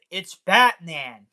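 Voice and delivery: excited announcement. Acted. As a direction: announce him to the crowd. Announcing Batman's arrival.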